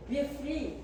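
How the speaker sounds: ordinary talk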